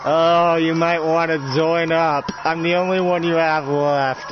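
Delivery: gloating offer; villain invitation. Acted. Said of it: "and he says".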